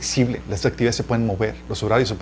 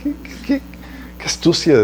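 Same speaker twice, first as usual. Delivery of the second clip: amazed; 5 semitones higher than usual. Real, not acted. Marvelling at the woman's cleverness.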